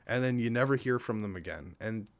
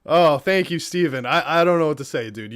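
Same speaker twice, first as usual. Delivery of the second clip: overwhelmed thanks; 4 semitones higher, 8 dB louder than usual. Real, not acted.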